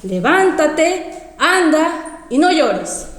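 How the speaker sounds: mandato muy lento, sílabas alargadas; voz del Señor citada. Retold or imitated. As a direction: ordena despacio, estirando cada palabra. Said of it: "Pero vuelta me decía". (orden solemne y pausada)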